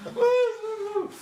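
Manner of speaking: high, loud hammed-up boo-hoo wail; comic high-pitched blubbering wail; mock wailing sob. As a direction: imitate a grown man bawling, overdone. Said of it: "And he started crying".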